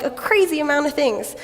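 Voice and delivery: normal talking voice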